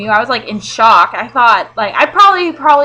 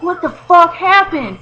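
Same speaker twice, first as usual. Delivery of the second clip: slow, higher disbelieving question; shocked boyfriend; 7 semitones higher, 4 dB louder, slower than usual. Retold or imitated. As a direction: drag it out in disbelief. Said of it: "he's like".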